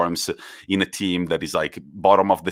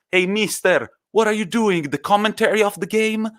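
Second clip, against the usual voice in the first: much higher, mock yell across the pitch; retold shout; 12 semitones higher than usual. Quagliarella teasing Gattuso across the pitch, high mock shout. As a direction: call out teasingly to the coach. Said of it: "he shouts".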